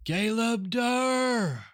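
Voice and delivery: very high, drawn-out hail; ringing wizard's call. Acted. Wizard hailing the stone creatures, high ringing call. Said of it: "her voice rings out".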